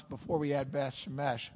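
usual speaking voice

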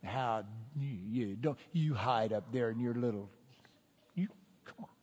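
low, halting tough-guy goading; taunting. Acted. King daring his rival to come out, low taunting. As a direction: goad a rival out of hiding.

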